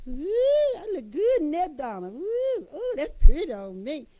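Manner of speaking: high, delighted coos at my own reflection; purring over how good I look; admiring myself in the mirror. Retold or imitated. Preacher admiring herself in the mirror, delighted high coos. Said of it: "I was in the mirror like this".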